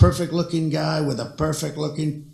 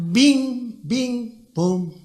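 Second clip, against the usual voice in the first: mock landing chimes; 8 semitones higher, much slower than usual. Imitating landing alert sounds.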